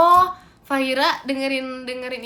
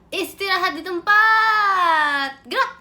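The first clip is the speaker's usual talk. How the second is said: very slow, stretched command, then short high "gerak"; drill commander; 5 semitones higher, 8 dB louder, slower than usual. Pretend. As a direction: call the drill order loud and long. Giving marching drill commands.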